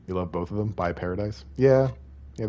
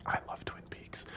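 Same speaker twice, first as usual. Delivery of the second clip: a soft breathy whisper, no voice at all; 16 dB quieter than usual. Real, not acted. Streamer confiding a love of Twin Peaks to chat, whispered.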